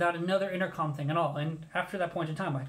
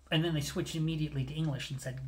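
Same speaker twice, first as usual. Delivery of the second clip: ordinary talk, nothing marked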